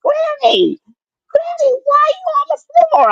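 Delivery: put-on high child's voice, calling out in alarm; imitating grandson yelling. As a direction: play the scared little boy shouting Granny. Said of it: "him yelling in his bedroom window".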